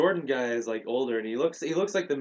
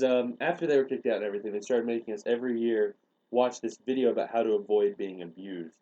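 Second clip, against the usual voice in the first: normal talking voice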